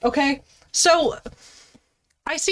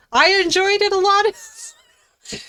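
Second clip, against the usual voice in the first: high, slow confession; coy and drawn out; sheepish admission; 8 semitones higher, slower than usual. Pretend.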